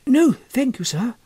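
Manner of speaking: lightly voiced schoolboy replying to his elder; polite young boy. A narrator's reading. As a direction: answer like a shy, well-mannered boy. The boy answers his cousin.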